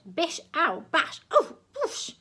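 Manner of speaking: high yelps as the trumpet strikes; pained yelps. A narrator's reading. Crowd members hit by the ear trumpet, yelping high.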